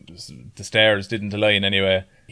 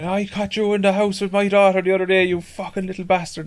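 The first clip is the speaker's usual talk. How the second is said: mock furious father, pitched higher; angry drunk father; 10 semitones higher than usual. Father yelling at the lad in the pub. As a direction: play the raging drunk father, half-hammed. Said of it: "And he was like".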